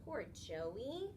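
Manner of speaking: usual speaking voice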